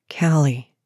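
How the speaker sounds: low, restrained male voice; man's warning voice. A narrator's reading. Man warning the woman stepping closer, low. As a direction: drop low to caution her. Man warns her as she nears.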